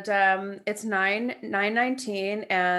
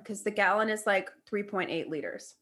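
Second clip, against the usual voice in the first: usual speaking voice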